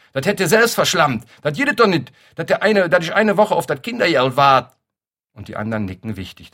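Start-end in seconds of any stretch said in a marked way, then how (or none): 0.1-4.8 shrill Kölsch shriek, much higher and louder. Acted. Neighbour woman at the prams, shrieking in Kölsch. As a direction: screech it in outrage.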